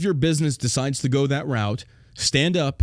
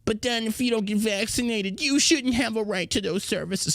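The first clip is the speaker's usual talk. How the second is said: mocking whiny voice; 7 semitones higher than usual. Host parodying hypocritical opponents in a higher sneering voice.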